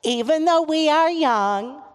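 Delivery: high, loud prayer line. Real, not acted. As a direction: call it out and leave room for the response. Leading children's call-and-response prayer.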